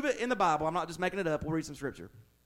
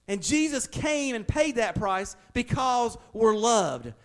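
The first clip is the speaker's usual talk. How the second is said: usual speaking voice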